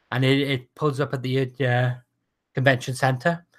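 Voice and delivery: normal talking voice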